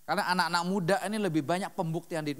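ordinary talk

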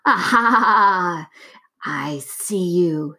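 witch voice. A narrator's reading. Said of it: "said the witch".

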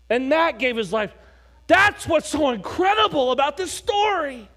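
fiery revival preaching, rising to a shout; full-force preaching. Acted. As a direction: preach it to the back row, building. Pastor preaching, building to shouts.

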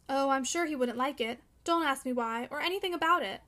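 ordinary delivery, nothing marked

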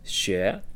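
Xue rising up at the end; second tone demo. Shown as a demonstration. Teacher giving the word for learning, demonstrating Xue. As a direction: lift Xue upward in one syllable. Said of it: "it sounds like this".

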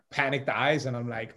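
usual speaking voice

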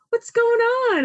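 high-pitched, concerned cousin voice; imitating a cousin's surprised concern; cousin's worried question. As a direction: pitch it way up like a startled cousin asking. Cousin asks her what's wrong.

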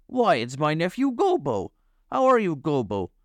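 high, put-on character voice; high-pitched hearty recognition; delighted uncle. A narrator's reading. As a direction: greet a nephew with happy surprise. Uncle recognises his nephew.

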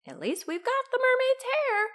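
very high, pleased crook; high-pitched storybook villain; gloating villain. A narrator's reading. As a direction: console yourself with the loot. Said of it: "said Hugh".

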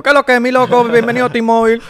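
a call center agent greeting a caller, in a brash Dominican voice. Retold or imitated. Like a Dominican call center guy. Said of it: "te sale un tipo".

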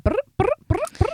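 high buzzing phone ring; phone ringing noise. Retold or imitated. Imitates frantic phone calls.

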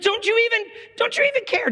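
very high, squeaky mimicry; mock panic. Preacher mocking the disciples' dumb question, mock panic.

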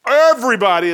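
loud, high preaching burst on Everybody; emphatic call. Real, not acted. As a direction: throw the word out to the whole room. Preacher calls out to congregation.

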